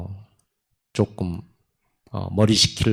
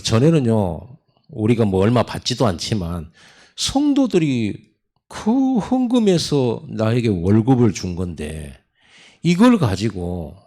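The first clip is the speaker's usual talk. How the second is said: ordinary talk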